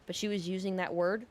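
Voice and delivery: ordinary delivery, nothing marked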